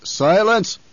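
loud, high command; commanding shout. A narrator's reading. Baron silencing his men, commanding shout.

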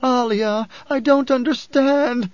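very high, loud, drawn-out wail. A narrator's reading. Bereaved mother cannot grasp the oracle, wailing. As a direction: wail it slowly in helpless anguish. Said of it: "the woman wailed".

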